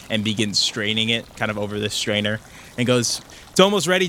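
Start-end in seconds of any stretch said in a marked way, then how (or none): 3.5-4.1 calling out. Acted. Nolan calls from the kitchen.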